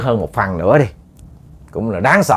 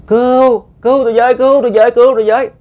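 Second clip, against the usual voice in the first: high, loud cries for help; kêu cứu; 12 semitones higher, 6 dB louder than usual. Retold or imitated. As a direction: call out for rescue in repeated high-pitched shouts. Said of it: "mới nghe la". (tiếng la cứu thất thanh, giọng cao vút)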